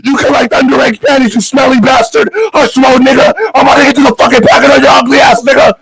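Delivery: yelling insults. Real, not acted. Escalating roast, yelling insults.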